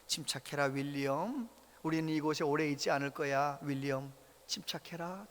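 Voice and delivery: soft, low, steady calming; calm soothing voice. Retold or imitated. Grandfather calmly soothing himself at the store, soft and low. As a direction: gently talk yourself down. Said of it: "차분한 소리로".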